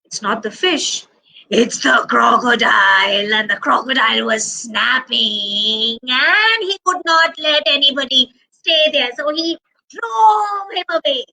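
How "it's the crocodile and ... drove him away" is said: suspenseful narration rising in pitch to the end; dramatic reveal. A narrator's reading. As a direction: announce the villain to wide-eyed children.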